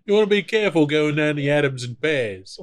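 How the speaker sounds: hammed-up Cockney voice; mock Cockney. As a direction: warn them in a put-on Cockney accent. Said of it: "That's my Cockney voice".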